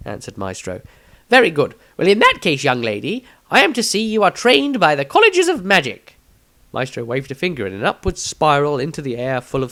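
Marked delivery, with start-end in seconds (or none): 1.3-6.1 high, loud, eccentric wizard voice; a self-important old wizard rattling off his passions, pitched high; pompous wizard. Acted. Maestro's voiced lines in story.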